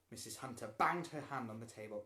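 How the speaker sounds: plain reading voice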